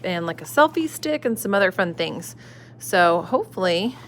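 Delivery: ordinary delivery, nothing marked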